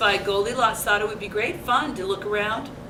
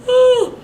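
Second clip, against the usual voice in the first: yawn; 13 semitones higher, 10 dB louder than usual. A narrator's reading. Goldilocks yawning.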